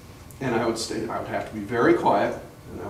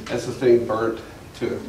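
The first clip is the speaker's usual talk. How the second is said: ordinary talk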